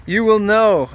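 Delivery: high, loud dramatic promise; dramatic reveal. Real, not acted. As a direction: build it up like a big reveal. Teasing the big reveal.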